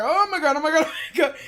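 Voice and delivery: high, loud, laughing "oh my God"; half-scared, half-laughing panic on the ride; mock panic. Dragon ride scare retold.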